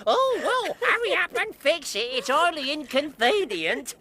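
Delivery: high, fussy mocking voice; the plumber mimics the haughty lady; imitating a posh lady. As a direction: mimic the snooty madam. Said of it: "And she says to me".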